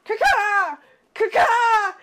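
high cawing crow imitation. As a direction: caw like a crow, high and loud. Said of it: "you hear this crow cawing".

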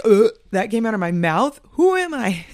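loud, appalled self-mockery, rising high; hammed-up horrified cringe; mock cringing disgust. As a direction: recoil loudly at your own voice. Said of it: "It's so cringe. I'm like".

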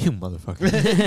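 laughing curse. Retold or imitated. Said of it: "I was like".

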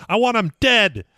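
high, loud mock-furious threat; Joe Pesci rage. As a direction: go full Pesci, spit the threat. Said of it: "he's like".

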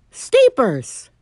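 high call to a pet; high pet-talk voice, falling at the end; animal voice. Real, not acted. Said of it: "That's my animal voice".

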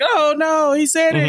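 high, gasping mock shock; hammed-up gasp of disbelief. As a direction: react like a scandalised viewer. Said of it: "I was like".

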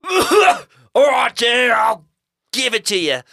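loud, high hammed-up yell; yelling in character. A narrator's reading. Eddie gives in to the ghost, high exasperated yell. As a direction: holler it down a tunnel. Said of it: "Eddie finally yelled into the mouth".

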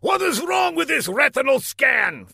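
high, angry shouted demand; thundering villain. A narrator's reading. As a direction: thunder at the incompetent underling. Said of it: "thundered Laszlo".

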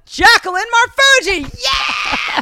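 high, loud, slow shouted name; hyped emcee shout. Retold or imitated. Showing how the emcee should announce her, shouting. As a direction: pump the crowd up.

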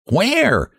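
high eager squeak; eager little pig. A narrator's reading. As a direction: ask brightly like a piglet.

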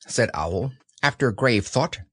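usual speaking voice

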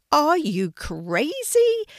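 high, loud, drawn-out shout of disbelief; angry shout. A narrator's reading. Brother yelling at sister in the pond, angry shout. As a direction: shout it slowly, pitched right up. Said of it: "he shouted".

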